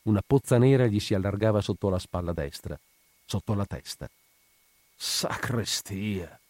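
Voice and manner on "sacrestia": very slow, soft, drawn-out exclamation; murmured oath. A narrator's reading. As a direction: murmur it under your breath at the sight. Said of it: "mormorò il brigadiere". (imprecazione mormorata, lenta)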